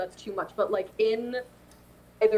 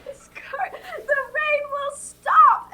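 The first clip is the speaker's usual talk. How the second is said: high hysterical wail, swelling louder; screaming; 4 dB louder, slower than usual. Acted. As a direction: start thin, then shriek it out in a fit. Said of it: "start screaming at".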